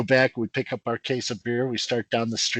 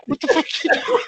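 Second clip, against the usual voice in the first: incredulous, much higher pitch; half-acted what-are-you-doing; mock disbelief. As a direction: react to a friend who just ratted you out. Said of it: "We all look at him like".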